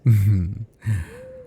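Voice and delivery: dark, delighted vampire laugh; sinister chuckle. Acted. Vampire imagines the chase, sinister chuckle. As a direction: chuckle at the thought of prey.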